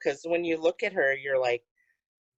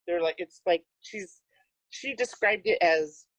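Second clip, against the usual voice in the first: ordinary talk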